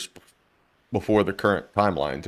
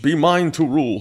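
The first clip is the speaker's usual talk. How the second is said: loud gloating villain; booming echoing villain monologue; 5 semitones higher, 4 dB louder than usual. Acted. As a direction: proclaim it like an evil tyrant. Villain claims the world.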